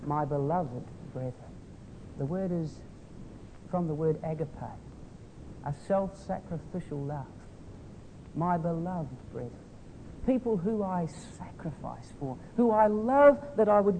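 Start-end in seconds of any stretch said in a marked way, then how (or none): 0.0-1.3 much softer and lower, tender; tender affection. Shown as a demonstration. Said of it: "words of tender affection". 8.3-9.5 much softer and lower, tender; tender affection. Shown as a demonstration. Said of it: "words of tender affection".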